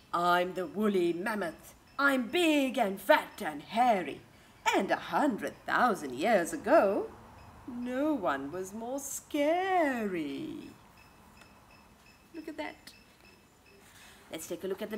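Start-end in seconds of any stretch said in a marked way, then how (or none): 0.1-10.7 a hint of a big proud mammoth, louder; lightly voiced woolly mammoth. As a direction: boast like a big hairy mammoth to the kids. Mammoth speaks in the book.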